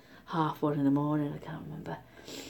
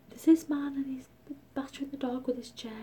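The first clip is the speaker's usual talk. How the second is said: high-pitched mock panic; retold hysteria; 8 semitones higher than usual. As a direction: replay a frantic call to the police, pitched up. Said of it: "I was so hysterical".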